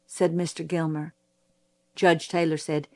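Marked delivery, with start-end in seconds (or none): none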